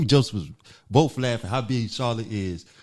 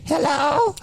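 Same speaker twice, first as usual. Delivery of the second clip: much higher put-on old-lady hello; 4 dB louder than usual. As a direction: disguise your voice as an old lady. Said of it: "So I disguised my voice first".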